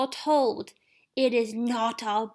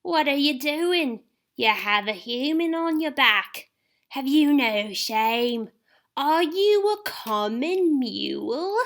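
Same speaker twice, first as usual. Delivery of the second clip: higher, slow, scornful challenge; an outraged centaur scolding Firenze; 9 semitones higher, slower than usual. Acted. Lane voiced angry.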